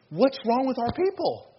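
high-pitched acted worried question; troubled questioners. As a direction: voice their complaint, pitched well up.

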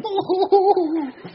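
very high comic wail; mock high-pitched hooting cry; imitated wife's wail. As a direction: imitate a spouse squealing from the corner. Acts wife's reaction in the corner.

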